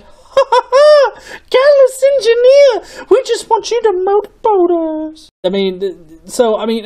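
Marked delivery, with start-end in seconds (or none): 0.0-5.3 high, loud, laughing put-on voice of sea women; mock ocean women. Mocking beer ad women calling to the drinker, high giggly voice. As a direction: ham up a giggling siren rising from the sea. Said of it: "big-breasted women aren't gonna pop out of the ocean and be like".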